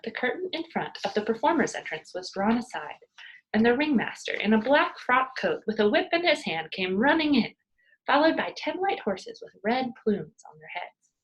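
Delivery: ordinary delivery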